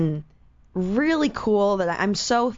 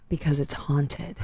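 slow, lower deadpan imitation; dead serious; 5 semitones lower, 4 dB quieter, slower than usual. Parking attendant explaining the broken elevator, dead serious. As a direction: state it slowly and low, utterly serious. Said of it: "like, dead serious".

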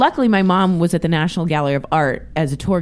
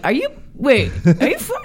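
incredulous; 6 semitones higher than usual. Retold or imitated. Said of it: "And now I'm like".

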